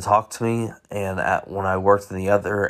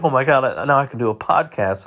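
higher-pitched, lightly gushing quote; mock excitement; 5 semitones higher than usual. Host voicing a reaction they didn't have, mock excited. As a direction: imitate a mildly thrilled reaction. Imagined joy at podcasting again.

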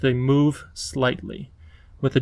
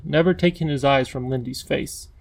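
ordinary delivery, nothing marked

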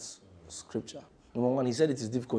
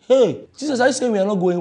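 higher, louder alarmed protest; shocked disciples; 7 semitones higher, 8 dB louder than usual. Acted. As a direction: cry out in disbelief.

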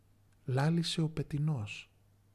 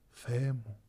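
Jesus repeats his cry, softer and fading; 2 semitones lower, 6 dB quieter than usual. A narrator's reading. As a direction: let the cry fall away weakly. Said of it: "φώναξε μια κραυγή".

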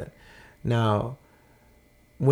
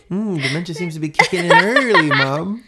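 mock reply through laughter; laughing mock reply; 6 semitones higher, 6 dB louder than usual. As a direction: tease her with a laugh in it. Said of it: "you're like".

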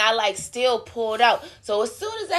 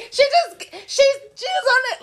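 high, laughing imitation of drivers; laughing mock quote; 14 semitones higher, 3 dB louder than usual. Retelling drivers mocking the girl, high and laughing. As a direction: mimic the drivers through laughter.

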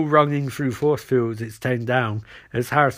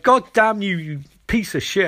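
higher start, dropping through the curse; mock angry outburst; 6 semitones higher than usual. Barry cursing Elbart, imitated angry outburst. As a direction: curse him out, lightly acted.

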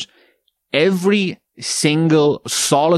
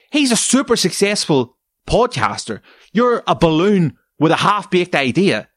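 angry rant; 8 semitones higher than usual. Real, not acted. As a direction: tear into the wannabe podcaster. Ranting at wannabe podcaster.

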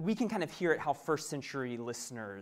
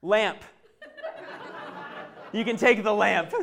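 put-on toddler offer; 5 semitones higher, 7 dB louder than usual. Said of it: "Shiloh goes".

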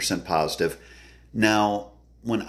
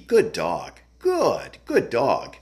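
higher-pitched, lighter praise for a dog; pleasing praise voice; 4 semitones higher, much slower than usual. Shown as a demonstration. Trainer showing clients how to praise a dog, pleasing higher voice. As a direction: lift the pitch high on "good", then swoop down. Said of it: "you're giving that voice inflection".

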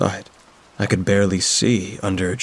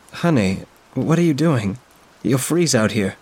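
a lightly voiced older woman, gently worried; concerned mother's friend; 5 semitones higher than usual. Friend finds her on the steps, lightly voiced concern.

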